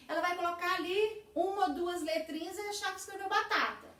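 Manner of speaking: ordinary delivery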